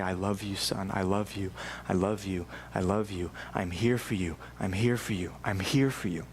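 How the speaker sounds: low, hushed reassurance to a hurting son; tender father. Acted. Father comforting his injured son.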